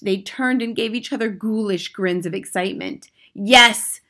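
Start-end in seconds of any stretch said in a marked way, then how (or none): 3.3-4.0 loud, higher thundering yes. Acted. Grand High Witch thundering to her audience, loud. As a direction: boom it at the hall. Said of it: "thundered the Grand High Witch".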